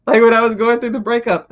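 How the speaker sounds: usual speaking voice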